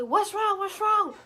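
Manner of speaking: high, loud worried questions; alarmed dad. Retold or imitated. Dad rushing to his screaming kid, alarmed. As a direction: imitate a panicked parent calling out.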